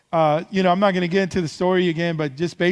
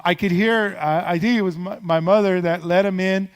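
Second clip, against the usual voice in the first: ordinary talk, nothing marked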